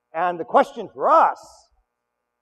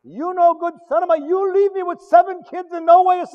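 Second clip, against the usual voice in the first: higher, louder furious cry at the dead husband; angry widow's outburst; 12 semitones higher, 7 dB louder than usual. Retold or imitated. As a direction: burst out at him in rage. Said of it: "She sounds pretty mad to me".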